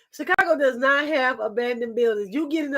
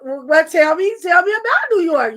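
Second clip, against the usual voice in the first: challenging; 3 semitones higher, 7 dB louder than usual. Real, not acted. Host challenges guest.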